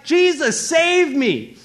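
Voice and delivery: high, loud plea for rescue; acted desperate cry. Peter drowning in the storm, acted desperate cry. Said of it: "he cries out because now he's drowning".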